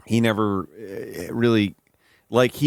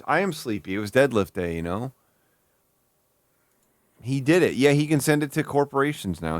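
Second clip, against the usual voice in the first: usual speaking voice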